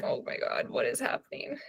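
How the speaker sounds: breathless whispered disbelief. Retold or imitated. Reader stunned by the plot, whispering to herself. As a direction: hush it like a secret shock. Retold shock while reading alone.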